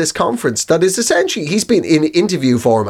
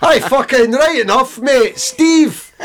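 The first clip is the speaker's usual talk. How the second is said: put-on higher Scottish accent, laughing; Scottish drunk; 6 semitones higher than usual. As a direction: careless drunk Scot waving names off. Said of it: "all of it is whiskey".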